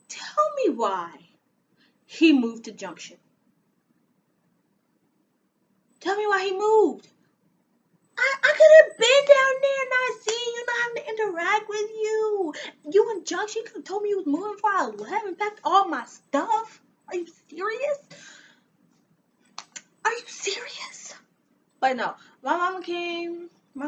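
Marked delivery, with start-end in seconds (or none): 0.1-7.1 rising exasperated rant; indignant rant at the ex. Retold or imitated. Ranting at ex about his move. 8.2-18.0 high, loud tearful wail; high, loud crying outburst. Retold or imitated. Reliving betrayal, crying out. 20.0-21.1 breathless whispered disbelief; whispered, stunned. Retold or imitated. Disbelief after outburst.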